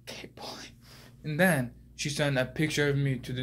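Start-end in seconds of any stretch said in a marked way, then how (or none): 0.0-0.7 whispered with disgust. Acted. Boy repeating his embarrassing hero name, whispered in disgust. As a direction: mutter the name like it tastes bad.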